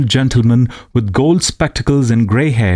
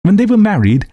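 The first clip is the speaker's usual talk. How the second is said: plain reading voice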